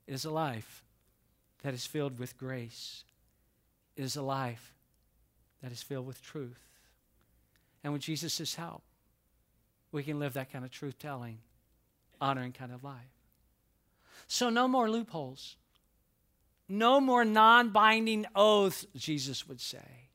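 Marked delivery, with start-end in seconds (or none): 0.0-10.5 soft, measured sermon emphasis; hushed, deliberate preaching; quiet preaching. Acted. 14.3-18.8 slow, louder, punching NO MORE; preacher rising to a climax; building preaching. Acted.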